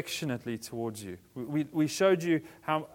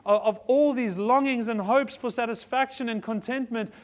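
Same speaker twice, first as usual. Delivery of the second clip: passionate preaching; 8 semitones higher, 5 dB louder than usual. Real, not acted. Preaching climax on good news.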